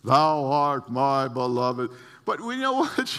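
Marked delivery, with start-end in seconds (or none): 0.0-1.9 slow, booming mock-solemn voice of God; put-on grand scripture voice, drawn out; holy reading. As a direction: intone it big and pious. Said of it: "read it a little more holy".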